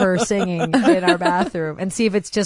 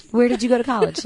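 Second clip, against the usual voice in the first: normal talking voice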